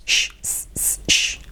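unvoiced "Sh, s, s, sh", each sound separate; isolated sh and s. Shown as a demonstration. Teacher breaking a phrase into its sounds, isolated sh and s. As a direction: hold "sh" and "s" as pure breath sounds. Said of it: "quiero que practiquéis conmigo".

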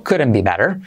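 American couldn't be better, tapped t, strong r; American r-coloured ending; American accent. Shown as a demonstration. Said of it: "Y la vocal se la come".

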